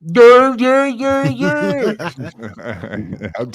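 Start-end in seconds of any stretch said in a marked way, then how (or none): 0.1-2.0 high, loud mocking dur-dur voice; mocking deaf speech. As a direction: blurt a crude mock of deaf speech. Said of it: "That was weird".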